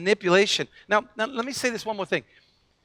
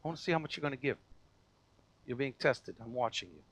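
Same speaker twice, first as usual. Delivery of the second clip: hushed voice of God; 6 semitones lower, 8 dB quieter than usual. Pretend. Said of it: "and say".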